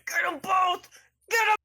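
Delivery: high, loud shouted order. Acted. Calzone ordering the others to attack, high shout. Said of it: "Calzone is like".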